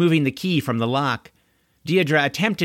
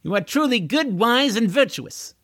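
sarcastic flattery; 8 semitones higher than usual. Acted. Deirdre mock-flatters Corwin.

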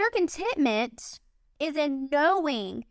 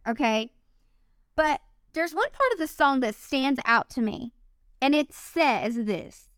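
usual speaking voice

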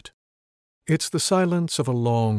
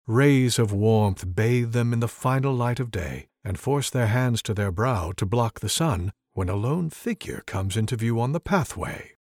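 ordinary delivery, nothing marked

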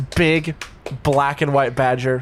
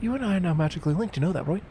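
soft, high Antipodean badger voice; hushed, pitched-up Australian character; Australian or New Zealand accent; 5 semitones higher, 7 dB quieter, faster than usual. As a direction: speak softly and quickly in an Aussie-Kiwi accent. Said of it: "I want Australian and or New Zealand".

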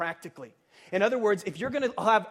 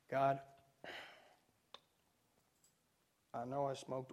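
much softer and lower, a guilty half-mumbled plea; sheepish prayer; 7 semitones lower, 14 dB quieter than usual. Pretend. Guilty believer pleading with God for a favour, hushed and sheepish. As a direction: confess under your breath, hoping to get away with it. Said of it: "what's happening in most of our minds".

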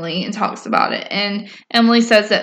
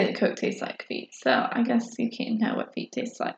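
half-whispered aside; 13 dB quieter than usual. Real, not acted. Joking aside on Coke and feet.

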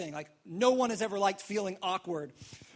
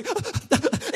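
higher, louder, gasping mock cry; imitated sobbing hiccups; 9 semitones higher, 5 dB louder than usual. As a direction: act out hiccupy crying, can't catch breath. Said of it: "doing the [name] hiccups".

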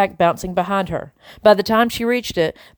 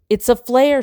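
raised, urgent scream; 6 semitones higher, 3 dB louder than usual. Acted. Tumble screams at Greg while her backpack burns. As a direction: shout the warning up high. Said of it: "she screamed".

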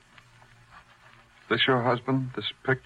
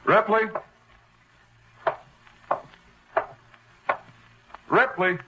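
calling out; 9 semitones higher than usual. Acted. Calling out for Ripley in apartment.

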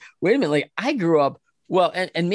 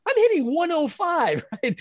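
much higher, mock disbelief; incredulous retort; 12 semitones higher than usual. Hitter answering teammate with self-mocking disbelief, much higher. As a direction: scoff at the idea of judging others. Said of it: "And I'm like".

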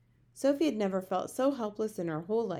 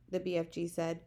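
ordinary delivery, nothing marked

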